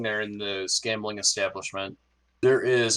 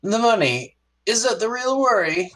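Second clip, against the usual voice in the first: much higher, searching first mate voice; probing first mate; 12 semitones higher than usual. Acted. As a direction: needle him about money or reputation. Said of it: "He stops and he turns to you and goes".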